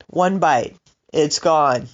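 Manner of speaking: ordinary talk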